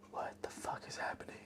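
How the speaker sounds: gasp, then a breathless whispered curse; shocked whisper. Retold or imitated. Woman catching her man on a date, in a shocked whisper. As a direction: whisper it in disbelief. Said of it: "She's like".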